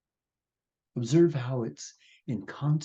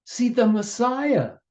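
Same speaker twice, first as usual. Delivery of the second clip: much higher, loud amazed cry; 10 semitones higher, 4 dB louder than usual. Acted. Downstream creatures cry out in amazement. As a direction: shout the wonder of a miracle.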